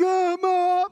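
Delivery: high, loud cry for Mom; imitating a kid yelling for his mother; mock wail. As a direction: wail like a hurt kid, hammed up. Injured kid crying out for mom.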